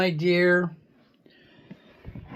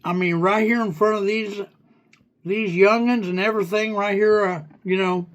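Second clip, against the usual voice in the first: pretend hillbilly; 2 semitones higher than usual. Correcting the AI in a light hillbilly accent. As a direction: play a rambling country bumpkin, lightly. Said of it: "pretend hillbilly slang".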